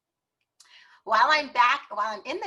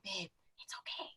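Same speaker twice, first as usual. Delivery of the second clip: whispered, calming the angry partner; soothing whisper; 17 dB quieter than usual. Retold or imitated. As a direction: hush him gently in a whisper. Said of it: "and I'm like".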